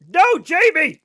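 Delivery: sudden loud, high protest; real heated shout; heated outburst. Real, not acted. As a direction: blurt it out, all worked up. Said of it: "I'm getting heated".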